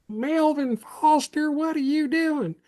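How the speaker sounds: high, slow, drawn-out yell from the sideline; coach yelling. Retold or imitated. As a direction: bellow it like a coach across the field. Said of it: "starts yelling".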